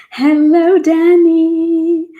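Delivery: high, loud cheerful hello; bright greeting. Real, not acted. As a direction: call out hello to Danny. Greeting a viewer aside.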